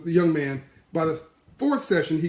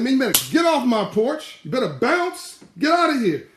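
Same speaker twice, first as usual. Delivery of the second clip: high and loud get off my porch; a father chasing the suitor off, high, loud and scornful; father's loud brush-off; 9 semitones higher, 5 dB louder than usual. Retold or imitated. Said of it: "He said".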